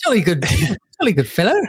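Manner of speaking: fast hammed-up posh British with laughter; mock posh British. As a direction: rattle it off like a stuffy British toff. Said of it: "they normally sort of speak".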